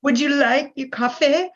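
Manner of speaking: put-on high-pitched Valley Girl with rising question endings. Coffee shop girl in a movie clip taking an order, Valley Girl tone. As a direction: mimic a Valley Girl, tune lifting at the end. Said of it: "a Valley Girl tone".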